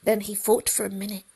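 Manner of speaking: usual speaking voice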